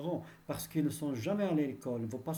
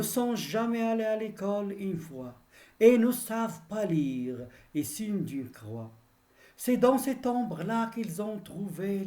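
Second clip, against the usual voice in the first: measured, declaimed verse, each line falling away; ringing classroom declamation of a poem; poem recitation; 3 semitones higher than usual. Acted. Recites Victor Hugo's poem to the class.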